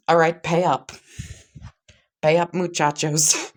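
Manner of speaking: loud, high hammed-up Mexican accent. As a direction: ham up a Mexican accent to collect the winnings. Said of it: "like, in a Mexican voice".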